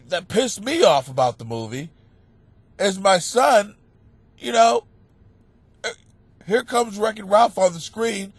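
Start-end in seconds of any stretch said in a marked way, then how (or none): none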